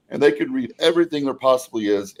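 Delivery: normal talking voice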